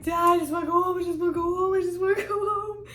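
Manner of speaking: retold tearful wailing, repeated and rising; bawling. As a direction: imitate a bawling plea, words running together. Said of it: "I'm bawling".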